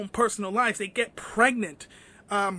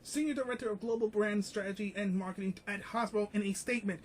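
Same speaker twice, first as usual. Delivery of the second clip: quiet, quick reading of a news article; 6 dB quieter, faster than usual. Acted. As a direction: read the article out quickly and softly. Reads article quietly and fast.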